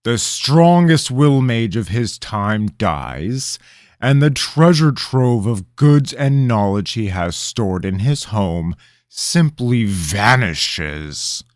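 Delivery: slow, loud, pointed reasoning; king reasoning aloud at the boy, sarcastic; suspicious king. Acted. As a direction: lay out the suspicion word by word.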